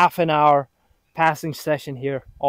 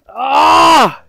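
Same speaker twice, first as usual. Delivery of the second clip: sharp high cry of pain; 10 semitones higher, 10 dB louder than usual. Real, not acted. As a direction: yelp out after slipping. Slips during drill.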